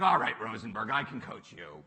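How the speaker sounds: a hint of a stern mentor giving in; Bill Campbell agreeing. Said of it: "But he's like".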